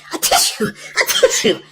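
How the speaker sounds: whispered sneezy words; sneezing. Acted. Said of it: "sneezed the witch".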